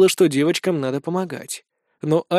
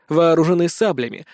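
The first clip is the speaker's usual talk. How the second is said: ordinary delivery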